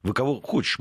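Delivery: normal talking voice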